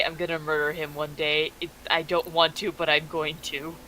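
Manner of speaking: low, soft mutter to oneself; muttering under breath. Acted. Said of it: "like, muttering under my breath".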